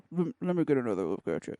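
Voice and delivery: lips held still, low voice; talking with mouth closed. Shown as a demonstration. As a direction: keep your lips shut as you talk. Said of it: "say it without moving your lips".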